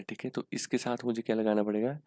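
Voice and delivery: normal talking voice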